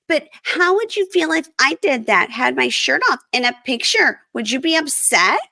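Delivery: high-pitched indignant wife impression; imitating upset wife. Voicing wife challenging husband in quarrel.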